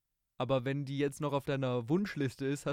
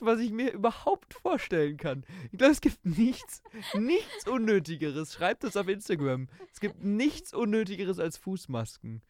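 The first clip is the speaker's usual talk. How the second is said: incredulous; 6 semitones higher, 3 dB louder than usual. Real, not acted. Exaggerated disbelief at foot masks.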